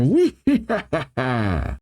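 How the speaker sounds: drawn-out mock cackle; slow, hammed-up character laugh; acted villain laugh. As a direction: stretch out a cartoon villain's laugh.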